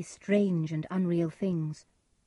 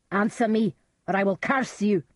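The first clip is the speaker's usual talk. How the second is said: crying out; 3 semitones higher, 6 dB louder than usual. A narrator's reading. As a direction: threaten them furiously. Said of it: "Macbeth cried".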